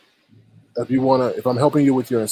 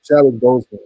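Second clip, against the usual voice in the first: usual speaking voice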